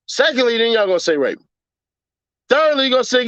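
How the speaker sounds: normal talking voice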